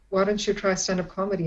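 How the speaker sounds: usual speaking voice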